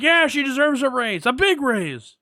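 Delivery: high-pitched, emphatic retold exclamation; excited mock agreement. Podcaster voicing the fairy arguing for her raise, high and excited.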